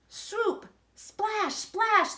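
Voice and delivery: high, bright splashing sound words; excited sound words. A narrator's reading. Reading the splashes to a child, excited. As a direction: make the splashes pop.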